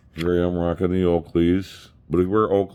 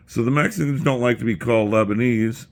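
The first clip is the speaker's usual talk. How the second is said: usual speaking voice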